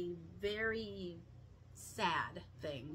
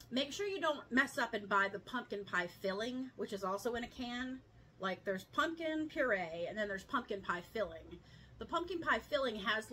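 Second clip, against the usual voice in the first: ordinary delivery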